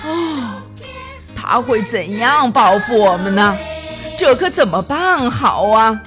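worried. A narrator's reading. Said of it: "康纳利斯忧心忡忡地自言自语道".

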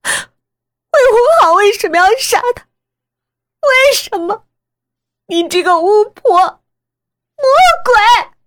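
very high, loud, raging shrieks; hysterical screaming. Acted. Daughter curses mother as a witch, screaming hysterically. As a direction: shriek questions and insults in hysteria. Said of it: "我歇斯底里地叫着".

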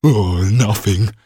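a slow, drawn-out "Oh, nothing" sinking in dismay; despairing. Acted. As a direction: groan "nothing" as if robbed yourself. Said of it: "he said despairingly".

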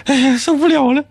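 squealing ugh, laughing in disgust; can't take it, cringing squeal; annoyed ugh. Real, not acted. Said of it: "太恶心了". (受不了地尖声嫌弃)

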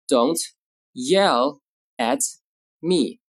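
slow, with a gap between words; word-by-word model. Shown as a demonstration. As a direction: dictate it calmly and slowly. Teacher models sentence word by word.

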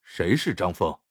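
low, puzzled question; taken aback. A narrator's reading. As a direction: ask blankly, not placing the name. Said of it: "繁星愣了愣".

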